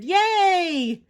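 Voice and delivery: high, loud whoop; cheering. A narrator's reading. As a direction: cheer with the crowd. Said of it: "the crowd cheered".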